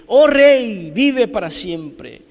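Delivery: loud, high, drawn-out proclamation; full-force preaching. Acted. Preacher declares Daniel's answer over the congregation, building full-force shout.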